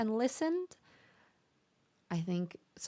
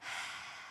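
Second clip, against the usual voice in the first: deep exhale; 13 dB quieter than usual. Shown as a demonstration. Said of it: "just taking a nice deep breath, just like".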